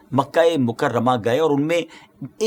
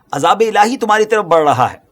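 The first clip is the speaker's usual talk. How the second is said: louder, higher acted warning cry; urgent warning; 5 semitones higher, 6 dB louder than usual. Prophet warning his people of approaching punishment, loud urgent warning. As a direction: raise the voice and sound the alarm.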